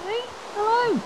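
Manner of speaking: squeaky high greeting. Pretend. Greeting a villager in a squeaky joke voice. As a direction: squeak hello like a cartoon critter. Greeting game characters in a silly voice.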